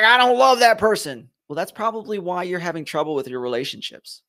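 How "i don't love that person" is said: raised, louder objection; mock protest. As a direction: act out a listener pushing back, higher and louder. Voicing a listener's protest.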